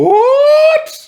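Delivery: very high, loud squeaked what; mock astonished what. Recalling the movie, a shrill astonished what. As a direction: yelp it in disbelief. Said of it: "I'm like".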